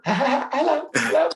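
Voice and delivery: very high, giggly lovestruck hello; mock-swooning falsetto greeting; giddy teenage crush. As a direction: squeak it like a starstruck teenager on a date. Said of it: "being on a date or being in the company".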